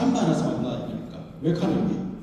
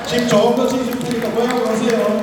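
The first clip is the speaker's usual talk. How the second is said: rousing call; 3 semitones higher, 7 dB louder than usual. Real, not acted. Rousing promise to the crowd.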